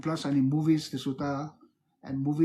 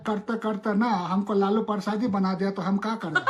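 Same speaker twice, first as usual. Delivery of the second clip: rapid, rustic politician's Hindi; Lalu Prasad; much faster than usual. Acted. Talking to madam on the live, comic politician impression. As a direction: chatter fast like a folksy Hindi politician. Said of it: "Talk to madam in Lalu Prasad accent".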